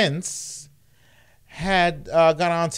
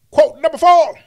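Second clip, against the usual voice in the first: announcer bark; 12 semitones higher, 11 dB louder than usual. Acted. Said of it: "announcing quotes".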